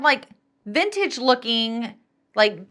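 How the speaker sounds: ordinary delivery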